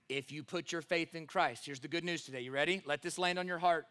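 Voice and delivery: ordinary delivery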